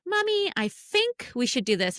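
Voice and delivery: put-on high, slow small-child voice; little kid voice. Mom imitating son sounding smart, high slow kid voice. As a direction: sound like a earnest toddler making a plan, slowly. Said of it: "then he'll be like".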